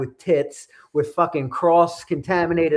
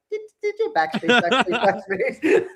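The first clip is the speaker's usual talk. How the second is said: high, laughing mock panic; laughing disbelief; 7 semitones higher than usual. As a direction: squeal it out through a laugh at yourself. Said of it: "I'm like".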